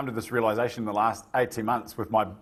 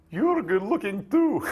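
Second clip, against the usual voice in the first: a jokey, over-high Russian lilt through a laugh; playful bad Russian accent, laughing; mock Russian accent; 9 semitones higher than usual. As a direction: do a terrible impression of his Russian wife. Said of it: "a terrible rendition of her accent".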